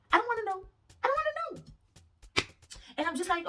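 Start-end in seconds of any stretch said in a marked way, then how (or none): 0.1-0.7 exasperated. Real, not acted. Exasperated about his thinking. 1.0-2.4 high, slow, stretched out; high drawn-out repeat. Real, not acted.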